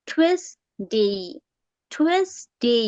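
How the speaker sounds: "টুয়েসডে" very slow, opening on a puffed T; aspirated-T pronunciation. Shown as a demonstration. Teacher showing the T, slow split model word. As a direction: split "টুয়েসডে" into "thuez... dey". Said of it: "টিটা তো এইভাবে হবে ঠি".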